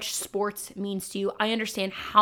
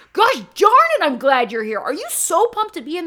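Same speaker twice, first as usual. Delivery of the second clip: much higher and louder, pumped-up welcome; hyped greeting; 8 semitones higher, 9 dB louder than usual. Retold or imitated. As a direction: cheer the kids in at full volume. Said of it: "sick and tired of hearing".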